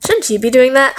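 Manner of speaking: ordinary delivery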